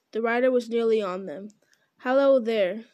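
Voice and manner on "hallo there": louder hail into the fog; calling out. Acted. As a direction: call out to a rider.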